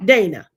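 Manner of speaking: "Dana" said as one word for D N A; Medea's pronunciation. Retold or imitated. Quoting Medea on D N A, "Dana" as one word. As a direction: say the letters as a name, "Dana". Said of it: "or as Medea say".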